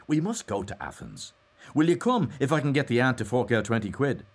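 eager, cheery invitation. A narrator's reading.

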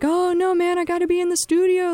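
high-pitched mocking artist voice; high, put-on musician's whine; mock session musician. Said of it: "kinda like poking fun at".

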